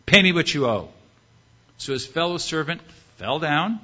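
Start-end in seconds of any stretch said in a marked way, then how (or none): 0.1-0.9 loud, abrupt mock demand; harsh demand. Forgiven servant choking his debtor, demanding. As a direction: demand the money forcefully.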